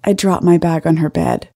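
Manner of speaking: ordinary delivery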